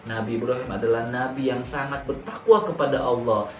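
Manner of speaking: plain reading voice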